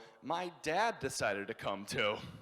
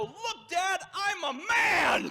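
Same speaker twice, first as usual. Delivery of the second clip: high shout stretched out at the end; triumphant yelling; 8 dB louder, much slower than usual. Retold or imitated. Son proving himself to his dad, high drawn-out yell. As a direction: proclaim manhood to your father at full voice. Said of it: "As I'm yelling at him".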